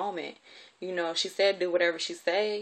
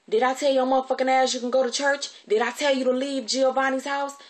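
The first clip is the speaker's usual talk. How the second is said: raised, loud furious telling-off; angry scolding; 6 semitones higher, 7 dB louder than usual. Retold or imitated. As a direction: imitate an irate guardian laying into a child. Acted scolding of guardian in car.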